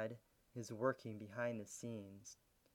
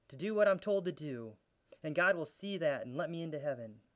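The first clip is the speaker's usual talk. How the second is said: full force preaching; 5 semitones higher, 8 dB louder than usual. Real, not acted. Preacher pressing the point on his listeners, loud and fervent. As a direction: drive it home to the congregation.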